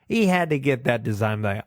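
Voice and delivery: normal talking voice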